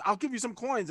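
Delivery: high-pitched character voice; character offering coins. Acted. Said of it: "jiggles out, and she goes".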